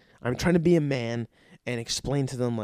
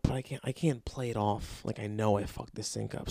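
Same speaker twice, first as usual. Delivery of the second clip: normal talking voice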